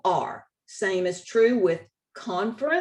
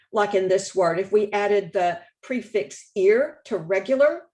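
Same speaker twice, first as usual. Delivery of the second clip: ordinary talk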